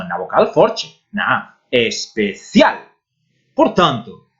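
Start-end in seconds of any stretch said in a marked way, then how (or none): 1.7-2.8 especial slowly broken up, landing on "cial"; stress on final syllable. Shown as a demonstration. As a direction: separate es, pe, then hit "cial" in especial. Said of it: "a sílaba tónica está na última sílaba".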